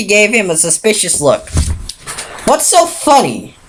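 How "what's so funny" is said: high, slow, affronted question; demanding. A narrator's reading. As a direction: demand an answer. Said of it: "she demanded".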